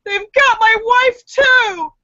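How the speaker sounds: high, loud, slow wail. Acted. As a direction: wail it out like a horrified drunk.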